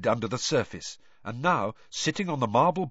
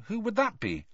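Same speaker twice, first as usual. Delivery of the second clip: cheerful mocking; 7 semitones higher, 5 dB louder, slower than usual. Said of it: "Moaning Myrtle so cheerful".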